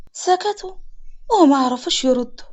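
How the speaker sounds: ordinary delivery, nothing marked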